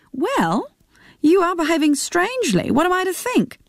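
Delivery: probing, half-laughing suspicion; mother suspicious of her son's secrecy, lightly voiced.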